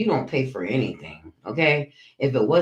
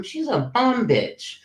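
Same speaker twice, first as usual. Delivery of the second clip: drawn-out insult; 8 semitones higher, slower than usual. Real, not acted. Insulting a rival on stream.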